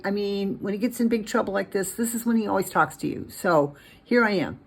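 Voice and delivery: hesitant, halting plea lifted in pitch; Agent Taylor praying. Acted. Taylor begs Gordy's God for help, earnest plea.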